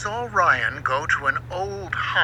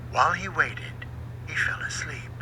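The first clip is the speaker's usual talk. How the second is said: usual speaking voice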